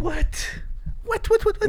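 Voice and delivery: very high, rapid-fire disbelief; incredulous. Real, not acted. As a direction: squeak out your disbelief over and over. Disbelief that friend forgot the night.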